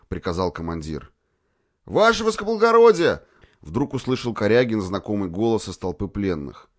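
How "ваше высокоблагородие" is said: high, loud call from the crowd. A narrator's reading. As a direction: call out to the officer. Prisoner calls out from crowd.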